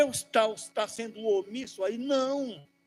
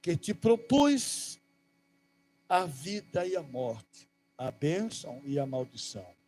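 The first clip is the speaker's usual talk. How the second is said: solemn scripture reading; 4 semitones lower, 5 dB louder than usual. Acted. Reads scripture over music. (leitura solene e pausada das Escrituras)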